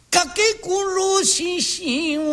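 over-pitched joruri wail. Acted. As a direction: push the key up to win them back. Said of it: "一つ調子を上げてな".

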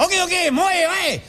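rowdy crowd yelling; angry heckling. Retold or imitated. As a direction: boo the reciter off. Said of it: "客騒ぎ出してな".

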